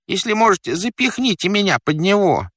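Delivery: very high, pleading character voice; flustered fat man. Acted. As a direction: beg for help in a squeaky voice. Stranded fat man begs for help. (просящий толстяк, очень высоким голосом)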